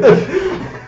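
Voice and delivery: high-pitched burst of laughter; high squeaky laugh. Real, not acted. Laughing at own story.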